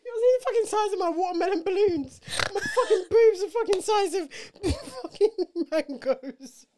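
tearful giggling rant; laugh-crying. Real, not acted. Complaining about period symptoms to her co-host, laughing through tears.

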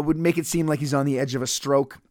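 ordinary talk

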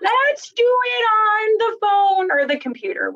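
high, slow teasing; mock exasperated friend. Friend mocking pen and paper writing, high slow tease. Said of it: "it was like".

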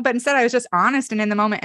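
normal talking voice

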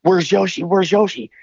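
put-on high, worried little-kid voice; imitating five-year-old self. Speaker as a five-year-old asking after the dog, high and urgent. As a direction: pipe up like an anxious small child. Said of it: "I'm five, and I go".

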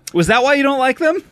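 normal talking voice

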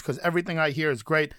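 ordinary talk, nothing marked

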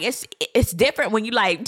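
ordinary talk

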